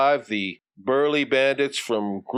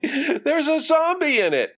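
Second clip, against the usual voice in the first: put-on very high, insistent little boy; exclaiming boy. Johnny explains to his mother, high and exclaiming. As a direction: squeal it out far above your own pitch.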